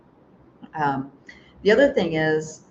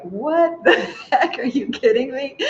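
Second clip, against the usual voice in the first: laughing disbelief; 8 semitones higher, 4 dB louder than usual. Retold or imitated. Passenger laughing at the stewardess's news, high and amused. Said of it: "I quite literally started laughing".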